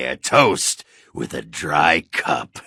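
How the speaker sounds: ordinary talk, nothing marked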